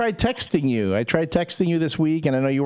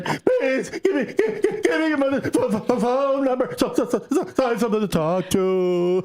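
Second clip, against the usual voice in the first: high, stammering mock begging; hammed-up stuttering plea; pleading imitation; 6 semitones higher than usual. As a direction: imitate a needy friend, tripping over the words. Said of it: "she kept going".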